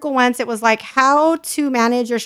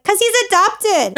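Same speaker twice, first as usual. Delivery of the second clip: put-on kid's deadpan answer; 9 semitones higher, 4 dB louder, slower than usual.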